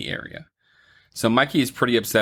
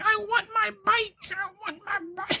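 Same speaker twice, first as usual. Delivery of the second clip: put-on very high, whining little-girl voice; whiny little girl; 5 dB quieter than usual. Data's little sister wailing for her stolen bike, very high whine. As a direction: whine it like a small kid who lost her bike. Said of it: "And she's like".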